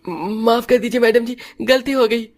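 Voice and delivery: a scared servant grovelling to his boss; frightened peon begging. Acted. Peon begs madam's pardon after she grabs his collar, high and scared.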